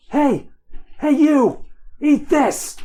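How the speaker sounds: high, loud taunting yell; yelling to draw attackers. Acted. Hero yells at attackers to cover friends' escape, loud taunt. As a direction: bellow it out to pull the guards onto you.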